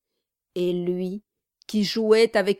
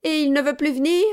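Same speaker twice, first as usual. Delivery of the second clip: feigned offence; 7 semitones higher, 5 dB louder, faster than usual. A narrator's reading. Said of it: "feignant de se lever de table".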